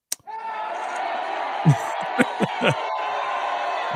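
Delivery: a high breathless wheeze of laughter; high wheezing laugh. Real, not acted. Host laughing at the player's name, a high wheeze. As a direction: cackle silently, high and airy.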